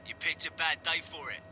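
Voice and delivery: grim Cockney soldier; Cockney accent. Acted. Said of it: "he said in a Cockney accent".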